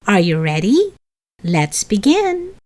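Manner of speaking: usual speaking voice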